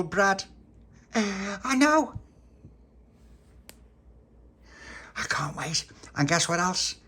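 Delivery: high, loud, bursting with glee; excited kid. Acted. Tony telling friends about bread and jam, high loud excitement. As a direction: gush like a kid who can't wait for jam.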